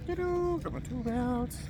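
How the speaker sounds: high mock ta-da. Showing off tool belt.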